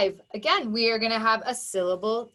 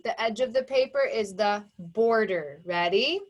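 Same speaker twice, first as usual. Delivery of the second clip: ordinary delivery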